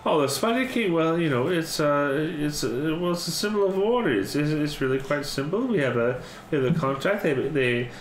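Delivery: high, chirpy little mouse lady; mouse matron voice. Acted.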